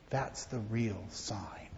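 slow, unvoiced whisper; closing whisper. Real, not acted. Pastor closing the children's sermon in a whisper. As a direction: whisper the point home.

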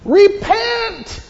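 put-on high, loud Southern-accented cry; Southern preacher shout. John the Baptist calling people to repent, loud Southern accent. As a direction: holler it like a Southern revival preacher. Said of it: "didn't say it in a southern accent".

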